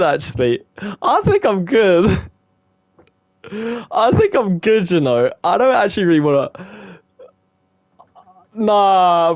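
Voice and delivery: high, loud nervous panic; scared squeal. Real, not acted. Gamer spooked by Sayori's creepy poem.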